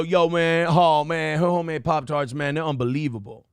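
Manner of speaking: imitating a bro accent; mocking Travis's accent. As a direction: parody a jock's laid-back accent.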